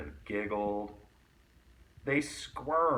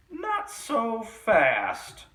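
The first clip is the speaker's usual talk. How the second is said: much higher, louder, slow and menacing; unpleasant hissing voice; 11 semitones higher, 7 dB louder, much slower than usual. A narrator's reading. Strict substitute stopping the unruly kids, slow menacing hiss. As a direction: stretch it out like a witchy threat. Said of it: "hissed an unpleasant voice".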